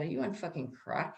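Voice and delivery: low, hushed incredulous aside; unspoken thought. Retold or imitated. Driver silently judging the officer, low muttered thought. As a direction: say it under the breath, as if only thinking it. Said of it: "but I'm thinking".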